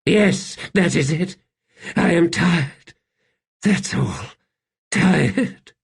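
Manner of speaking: high, halting tired voice; strained, high-pitched weariness; weary emperor. A narrator's reading. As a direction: admit exhaustion in broken little phrases. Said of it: "when exhaustion assails you".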